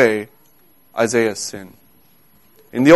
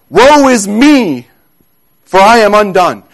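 raised-voice lament, stretched out; crying out; 3 semitones higher, 4 dB louder than usual. Acted. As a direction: proclaim the woe in a raised voice. Acts out Isaiah's cry of woe.

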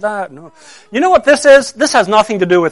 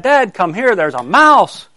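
mild mock scream, higher pitched; retold scream; 5 semitones higher, 5 dB louder, slower than usual. Daughter calls Dad about a mouse, mild mock scream. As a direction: imitate an alarmed call for Dad, louder at the end. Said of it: "and she screamed".

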